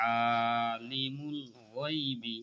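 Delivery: slow আলিমুল গায়বি with a thick, heavy গায়বি; heavy ghain. Shown as a demonstration. Teacher showing the heavy ghain, slow recitation. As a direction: draw out গায়বি from the throat, thick. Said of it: "গায়বি মোটা করে পড়তে হবে".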